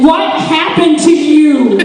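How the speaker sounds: high, slow, laughing question; shocked friend. Retold or imitated. Friend seeing the shirtless disaster, shocked. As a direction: stretch it out in shock. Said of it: "and they say".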